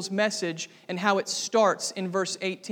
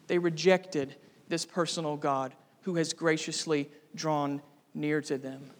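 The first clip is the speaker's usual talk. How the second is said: ordinary talk, nothing marked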